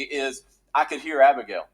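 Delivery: ordinary talk, nothing marked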